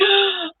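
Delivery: sudden high intake of breath; re-enacted gasp. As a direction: gasp as if just remembering. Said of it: "and I was like".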